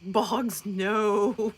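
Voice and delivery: ordinary talk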